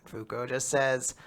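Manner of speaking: ordinary talk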